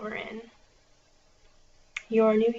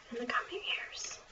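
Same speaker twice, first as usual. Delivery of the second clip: library whisper; 2 semitones higher, 8 dB quieter than usual. Acted.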